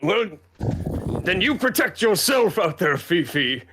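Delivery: high, pleading character voice; hammed-up fretful send-off; emotional guardsman. Guardsman answers Fifi, acted farewell.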